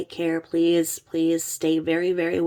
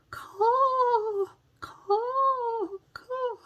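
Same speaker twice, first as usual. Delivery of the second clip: crow caw; much slower than usual. Shown as a demonstration. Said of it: "lean forward and go".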